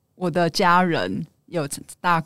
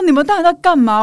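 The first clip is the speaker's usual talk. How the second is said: angry shout, higher and louder; 8 semitones higher, 6 dB louder than usual. Retold or imitated. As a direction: snap at them in alarm. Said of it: "有点怒".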